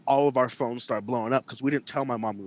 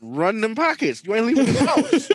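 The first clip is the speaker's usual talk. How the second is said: mock stickup; 8 semitones higher, 4 dB louder than usual. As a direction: bark it like a cartoon stickup kid. Repeats the stickup line as a bit.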